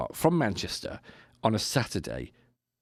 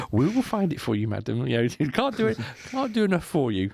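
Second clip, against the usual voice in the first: higher, eager servile tone with a laugh; fussy stewardess; 6 semitones higher than usual. Retold or imitated. Stewardess reassures the panicking wife, eager and high. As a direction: gush with over-keen politeness. Stewardess fussing over passenger.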